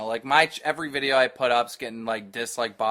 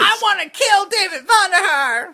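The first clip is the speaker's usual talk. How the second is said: very high, loud mock tantrum; hammed-up wailing rage, strained way up high; enraged fan parody; 9 dB louder than usual. Host mimics furious gamers over quickscoping, hammed-up wailing rage.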